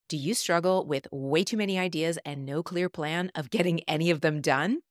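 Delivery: upbeat promo read. Acted. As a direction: pitch it like a radio spot. Recorded promo for her program.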